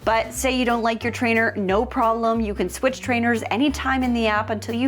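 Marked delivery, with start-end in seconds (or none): none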